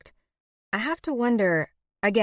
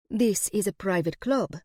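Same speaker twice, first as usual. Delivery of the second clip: put-on Eastern European accent; 2 semitones higher than usual. A narrator's reading. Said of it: "in a thickly Eastern European accent".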